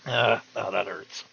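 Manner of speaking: usual speaking voice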